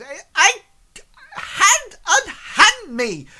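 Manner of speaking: high, loud spluttering outrage; outraged thin man. Acted. Tall thin man seized by guards at his door, high spluttering protest. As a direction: stammer and squawk in hammed-up indignation. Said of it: "like this".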